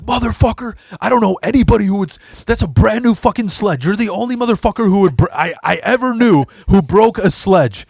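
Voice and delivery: imitating angry boss. Boss yelling about broken sledge.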